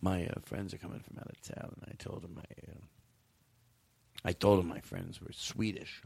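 very quiet, low, halting request; hushed acting. Friend asking for a favor about visitors from out of town, hushed and halting. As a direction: play it small and hushed, with hesitant pauses.

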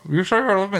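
high mock voice from inside a turkey; imitating muffled speech. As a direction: garble it as if your head is stuffed in a turkey. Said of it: "'cause she's inside the turkey's head".

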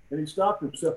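ordinary talk, nothing marked

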